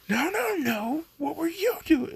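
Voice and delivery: high, embarrassed accusation; flustered squeal. Retold or imitated. Blushing Katsuka accusing Izuku, in a high flustered squeal.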